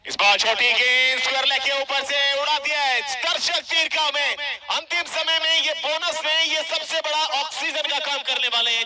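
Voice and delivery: loud, high shout over the crowd; excited cricket commentary. Real, not acted. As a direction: roar the shot to the crowd. Commentator calls a big hit.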